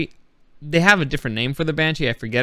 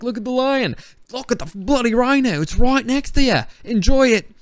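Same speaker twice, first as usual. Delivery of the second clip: imitating excited tourists; 10 semitones higher, 4 dB louder than usual. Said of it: "everybody's like".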